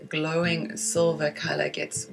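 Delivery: measured meditation guiding with long pauses for breathing; guided meditation. Acted. Teacher guiding listeners through breath and silvery-light visualization, guided meditation voice. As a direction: lead listeners gently inward, leaving space after each phrase.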